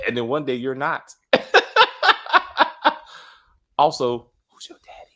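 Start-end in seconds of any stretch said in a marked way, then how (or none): 1.3-3.0 a sudden high burst of laughter; loud high laugh. Real, not acted. Laughing at own joke. 4.5-5.1 breathy whispered question. Acted. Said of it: "No, I'm serious".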